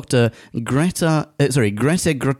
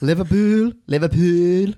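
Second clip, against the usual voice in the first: higher, exaggerated Scouse, rising each time; Scouse accent; 6 semitones higher than usual. Shown as a demonstration. Trying out Scouse for a co-host, hammed-up accent. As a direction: lay the Scouse on thick. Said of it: "Why don't you give us your Scouse accent".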